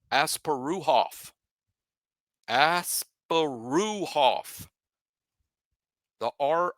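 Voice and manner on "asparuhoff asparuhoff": Asparuhoff drawn out, as-pa-ROO-hoff; slow careful pronunciation. Shown as a demonstration. Host teaches the punter's name very slowly. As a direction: stretch Asparuhoff syllable by syllable, ROO in the middle. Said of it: "His last name is properly pronounced".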